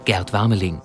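usual speaking voice

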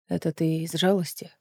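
low, soft voice; subdued intimate question. A narrator's reading. Aedion doubts his lover's reasons, low and subdued. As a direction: ask it low and quietly, exposed.

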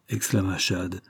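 ordinary delivery, nothing marked

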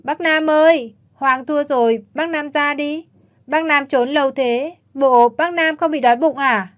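put-on high, loud child's call; child shouting. A narrator's reading. Little boy calls out to his hiding uncle, loud and high. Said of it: "con hét lớn".

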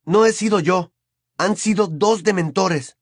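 insist, patience running out; Harry, impatient. A narrator's reading. Harry denies it to his uncle, impatient. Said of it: "se le estaba agotando la paciencia".

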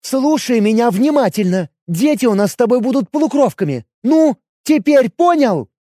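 jealous elf questioning her man; elf woman voice. Acted. Elf drilling it into him.

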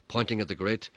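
usual speaking voice

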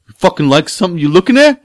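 high, loud imitation of an aggressor; mock confrontational yell. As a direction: challenge them like a hostile stranger. Acting out hostile stranger's challenge.

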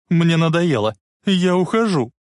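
fed-up shout. A narrator's reading. Lorraine walking out on Biff, fed-up shout. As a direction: shout it out, had enough. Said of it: "крикнула она".

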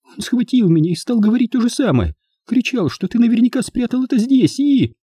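high-pitched tearful pleading; through tears. A narrator's reading. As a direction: beg him to believe, voice pitched well up. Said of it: "заговорила Луиза сквозь слёзы".

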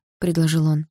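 ordinary delivery

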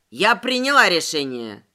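low-pitched angry verdict; enraged. A narrator's reading. Тётка announces her decision to Воронов, enraged. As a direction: declare it heavily, no appeal. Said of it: "перебила его разгневанная тётка".

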